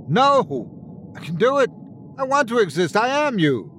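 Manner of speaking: high-pitched, desperate cries; desperate protest. Acted. Twin protests while being destroyed.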